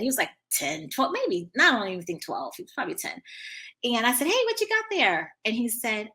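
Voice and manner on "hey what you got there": put-on high-pitched question to a little kid; acted high, inquisitive greeting; friendly curiosity. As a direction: ask a child, voice lifted way up. Acted greeting to a young boy.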